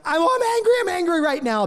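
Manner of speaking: very high venting cry, sliding back down; mock blow-up. Mom or dad blowing up harmlessly, high-pitched mock venting. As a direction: blurt out harmless anger high, then come down. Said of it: "blow up".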